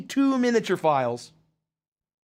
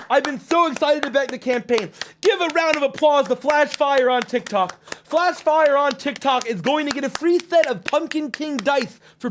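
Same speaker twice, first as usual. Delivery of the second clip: higher, louder hype-host delivery; thrilled livestream announcement; excited shout-out; 8 semitones higher, 4 dB louder than usual. Real, not acted. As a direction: call for applause for a backer. Cheering a new backer live.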